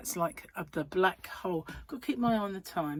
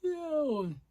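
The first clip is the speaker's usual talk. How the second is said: high, falling, drawn-out "yawn"; suggestive yawn word; 8 semitones higher than usual. Retold or imitated. Just saying the word to set coworker off, high yawny glide. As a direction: slide down the word as if yawning. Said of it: "Yeah, say the word".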